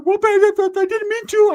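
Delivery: high, loud stammering whine; mock panicked pleading. Ricky after hitting the boy, high panicked excuse.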